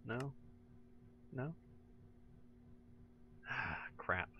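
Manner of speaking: ordinary talk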